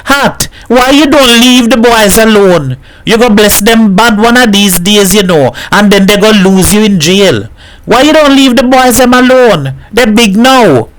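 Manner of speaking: high-pitched, insistent protest; calling out. A narrator's reading. Baku protests from under the car, calling out. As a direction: call out in protest at a neighbour. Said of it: "Baku called".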